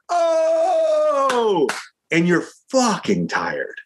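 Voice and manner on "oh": long high drawn-out groan; hammed-up crew groan at cut; mock weary groan. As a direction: imitate a crew sighing with relief, stretched way up high.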